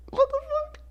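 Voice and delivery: tiny high squeak of retold shock; acted disbelief. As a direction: squeak it softly, in mock outrage. Acting out his shock, squeaked.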